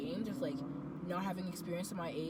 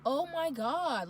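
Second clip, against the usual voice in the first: shocked; 7 semitones higher, 6 dB louder, much slower than usual. Retold or imitated. Shocked by his insults.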